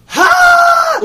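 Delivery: sudden loud, high "ha!"; shrieked one-note laugh; imitated burst of laughter. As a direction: mimic a friend cracking up out of nowhere. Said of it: "Just started laughing at it".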